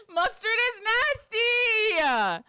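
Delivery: drawn-out high disgusted whine. Real, not acted. Streamer whining to chat about mustard, disgusted. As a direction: stretch out nasty in disgust.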